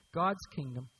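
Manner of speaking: normal talking voice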